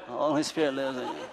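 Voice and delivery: barely voiced, soft and laughing, mocking the lazy crowd; lazy mumble. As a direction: mumble it lazily without turning your head. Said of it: "You're like this".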